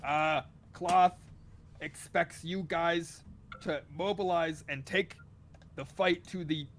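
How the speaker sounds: loud, halting envoy reading from notes; Dragonborn reading aloud. Acted. Envoy reads the dragon's wishes from a journal, loud and halting. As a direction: read it out slowly and loudly, in fits and starts. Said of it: "traces a finger as he reads it out".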